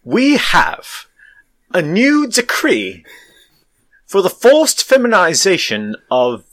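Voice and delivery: hammed-up British decree; Boris Johnson. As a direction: proclaim it in drawn-out chunks with long pauses. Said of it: "give me Boris Johnson's, uh, femboy decree".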